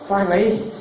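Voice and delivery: ordinary talk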